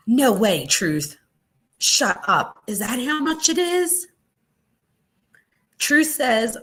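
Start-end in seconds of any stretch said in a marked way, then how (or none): 0.1-4.1 high pitched, shocked disbelief; can't believe the price. Real, not acted. A vlogger reacting to a dog's price, shocked and high.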